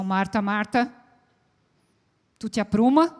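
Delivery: impatient reprimand to a stressed woman; scolding tone. Shown as a demonstration. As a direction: scold her to straighten up. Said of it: "A gente pode dar uma entonação".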